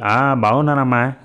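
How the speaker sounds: ordinary delivery, nothing marked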